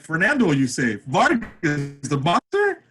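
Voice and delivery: high, loud, eager questions; can't believe it's him; excited disbelief. Retold or imitated. Teller on the phone with the manager, high loud disbelief.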